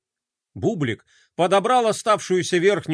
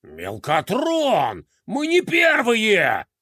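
angry growl; 4 semitones higher, much slower than usual. Acted. General scolding his adjutant for losing, angry growl. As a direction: growl his name, then spit out the rest. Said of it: "гневно прорычал Декроль".

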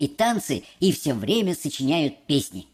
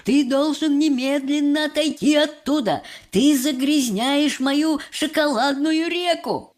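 higher, agitated outcry; Mr Wonka; 6 semitones higher, 3 dB louder than usual. A narrator's reading. Chocolate maker orders boy away, agitated cry. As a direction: cry out the order, drawing out the complaint. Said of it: "кричал мистер Уонка, подпрыгивая на месте".

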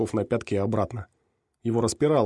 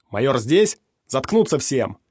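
commanding shout; 3 semitones higher, 6 dB louder, slower than usual. A narrator's reading. Rusak commands crowd.

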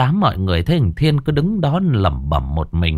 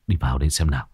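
low, quiet gruff father; 7 semitones lower, 5 dB quieter, much faster than usual. A narrator's reading.

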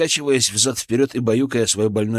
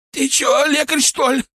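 high, strained moaning question; pained groan; 5 dB louder than usual. A narrator's reading. Injured Козёл asks if he is a healer, pained groan. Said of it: "простонал козёл".

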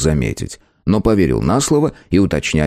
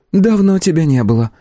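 cool, detached hostess voice; tavern hostess; 10 semitones higher than usual. A narrator's reading. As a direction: say it without much interest. Hostess character voice.

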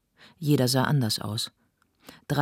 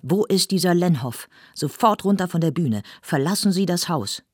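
loud, higher barked commands; furious orders; 5 semitones higher, 5 dB louder than usual. Acted. Zilenziger orders Lenhoff out of the theatre, furious. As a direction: throw him off the stage.

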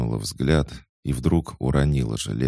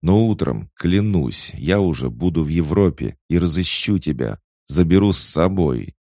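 voice breaking; 5 semitones higher, 6 dB louder than usual. Acted. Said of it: "Голос Ивана срывался".

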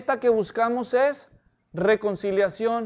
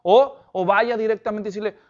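ordinary delivery

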